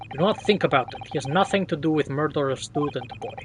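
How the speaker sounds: lightly voiced Russian sailor, grumbling threat; a hint of a surly Russian seaman; Russian accent sailor. Sailor threatening to throw stowaway overboard, light Russian accent.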